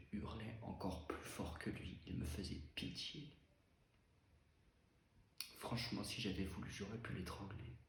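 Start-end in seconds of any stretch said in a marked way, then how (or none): none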